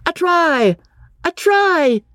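very high, drawn-out sporting cheer; excited yell. A narrator's reading. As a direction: yell the score high across the field. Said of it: "yelled Freddy".

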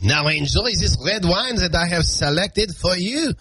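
hammed-up French waiter accent; exaggerated, suave French accent. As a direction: present the wine in a mock French accent. Puts French waiter voice back on.

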